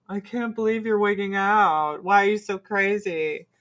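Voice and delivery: mocking husband. Husband to wife wigging out, mock exasperation. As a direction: imitate an exasperated husband, dragging the words. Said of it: "my husband is like".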